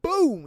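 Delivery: loud, high boom; storyteller mimics the gunshot, loud; imitated explosion. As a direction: burst it out like a blast. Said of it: "retelling the blast".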